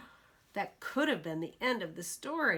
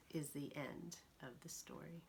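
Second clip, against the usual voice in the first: whisper; 3 semitones lower, 13 dB quieter than usual. A narrator's reading. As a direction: whisper the ending softly. Whispered story ending.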